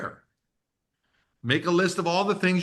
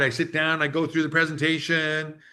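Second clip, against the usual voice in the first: ordinary talk, nothing marked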